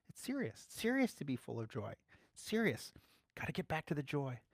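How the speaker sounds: ordinary delivery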